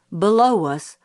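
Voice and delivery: plain reading voice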